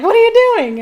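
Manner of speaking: high, loud mock disbelief; baffled outcry. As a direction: imitate a bewildered crowd's protest. Acts out everyone's baffled reaction to Lupin.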